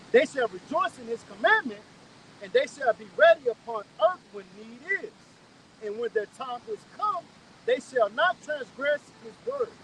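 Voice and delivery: slow, loud, emphatic scripture reading; measured, ringing recitation with pauses between phrases. Real, not acted. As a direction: proclaim each phrase slowly and forcefully. Reads scripture aloud emphatically.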